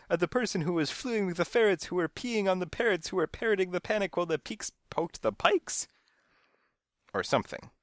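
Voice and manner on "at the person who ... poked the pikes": high-pitched, frantic pile-up of chaos; mounting frenzy. A narrator's reading. Narrator recounts the animals' chaos, frenzied. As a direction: climb into a frenzy as the list snowballs. Chaos piles up in breathless list.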